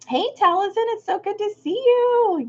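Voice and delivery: usual speaking voice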